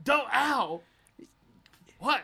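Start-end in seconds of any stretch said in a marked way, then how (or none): 0.3-0.8 pained yelp. Acted.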